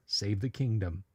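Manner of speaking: ordinary talk, nothing marked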